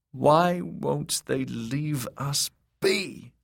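high, drawn-out lament; despairing high-pitched plea; wailing. A narrator's reading. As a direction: wail it slowly in distress. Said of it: "wailed Elaine".

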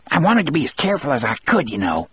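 high, fast, fussy scold; hurried, reedy telling-off; thin, scolding voice. A narrator's reading. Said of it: "in a thin, scolding voice".

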